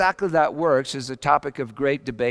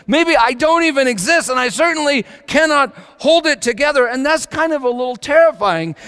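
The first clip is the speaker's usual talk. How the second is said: starts lifted, climbs steadily higher and louder into a near shout; building fervent preaching; 14 semitones higher, 10 dB louder than usual. Acted. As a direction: preach it like a spiral you can't escape, rising to a roar. Preaching builds to full force.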